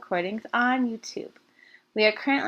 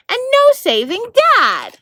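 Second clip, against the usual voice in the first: rising desperation; 12 semitones higher, 12 dB louder than usual. A narrator's reading. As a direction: pitch leaps higher on the last words. Narrator builds to desperate climax.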